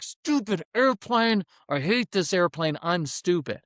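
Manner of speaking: high, mock childish tantrum; intense frustrated kid. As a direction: whine it like a kid upset with himself. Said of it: "The intense kids are like".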